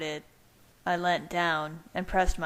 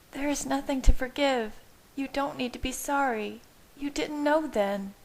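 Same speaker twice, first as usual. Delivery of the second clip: higher Bella voice with a touch of tears; Bella, tearful; 8 semitones higher than usual. A narrator's reading. Bella tearfully tells Edward there is nothing to forgive. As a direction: plead through light tears, higher. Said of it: "her voice coarse with tears".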